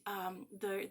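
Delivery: usual speaking voice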